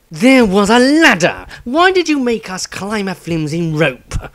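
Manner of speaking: put-on furious old man scolding; angry outburst. A narrator's reading. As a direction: tear into the halfling. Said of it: "Glaring at the halfling".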